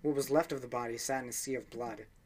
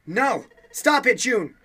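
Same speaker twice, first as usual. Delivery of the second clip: much higher, much louder alarmed shout; 10 semitones higher, 15 dB louder, slower than usual. Acted. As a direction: yell to stop her. Junpei stops Jun hurting herself.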